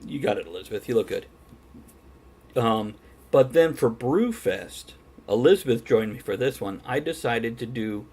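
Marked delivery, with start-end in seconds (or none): none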